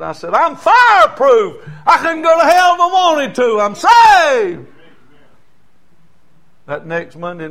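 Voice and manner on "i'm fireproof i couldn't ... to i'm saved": high, loud preaching shout, slow at first; retold fiery jail sermon at full force; excited shout. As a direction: cry it out in joyful triumph. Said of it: "I got so excited".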